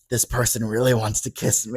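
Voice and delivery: lightly acted drunk murmur, low and soft; drunk friend. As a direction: imitate a tipsy friend confiding, understated. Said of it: "when he's drunk and he's like".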